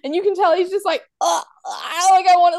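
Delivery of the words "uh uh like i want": imitating a man bursting to say more; high, halting, louder mock stammer; mock tongue-tied. Retelling his chat with Trixie, high mock tongue-tied. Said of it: "he just wants to say more".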